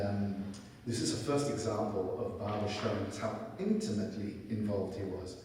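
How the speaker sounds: ordinary delivery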